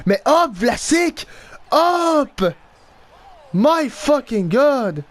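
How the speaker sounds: high, slow, stretched-out exclamations; exasperated. Real, not acted. Player urging Vlasic to move, exasperated. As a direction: drag the words out in exasperation.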